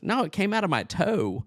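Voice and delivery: high, put-on boyish voice; Turkey Feather. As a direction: correct him like a cartoon kid. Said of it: "looking and sounding like Bobby Hill".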